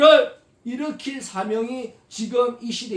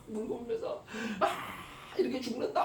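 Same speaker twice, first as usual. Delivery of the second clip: 1 acted weeping; 3 semitones higher, 10 dB quieter than usual. Preacher acts weeping Hagar.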